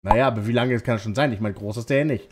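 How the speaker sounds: ordinary talk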